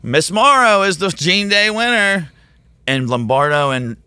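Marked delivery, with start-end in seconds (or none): 0.0-2.3 much higher and louder, a mock official announcing the winner; announcer. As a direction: proclaim it like the meeting host. Drawing winner announced at staff meeting.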